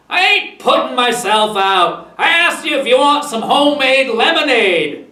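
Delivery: annoyed. A narrator's reading. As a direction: snap back loudly, building on the second sentence. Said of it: "Kenneth was annoyed".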